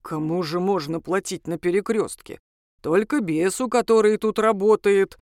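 demon's bass rising into surprise; puzzled demon explaining the obvious; surprised demon. A narrator's reading. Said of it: "удивился он".

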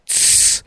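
sharp voiceless hissed shush; a sudden "tsss" for silence. A narrator's reading. Fisherman silencing his companions, sudden hissed shush. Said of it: "вдруг сказал рыбак".

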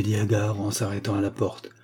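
ordinary delivery, nothing marked

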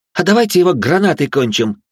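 excited, loud and high; eager shout. Acted. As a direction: pitch it eagerly over the fight. Eager battle suggestion.

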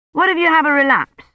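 put-on high, questioning boy's voice; worried young Colin. A narrator's reading. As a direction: pitch it up, challenging the adult.

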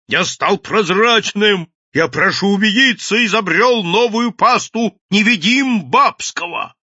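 high, loud and drawn out; wailing proclamation. A narrator's reading. Mad inventor announcing his new paste to the crowd, wailing yell. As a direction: holler it like a crazed inventor. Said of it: "вопил голый".

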